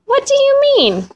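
a mother suddenly alarmed; shocked question. A narrator's reading. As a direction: leap up in disbelief. Mama shocked the pot's missing.

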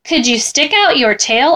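plain reading voice

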